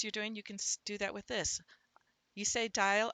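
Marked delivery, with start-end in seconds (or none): none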